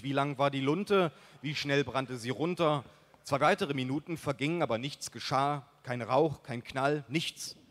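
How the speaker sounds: plain reading voice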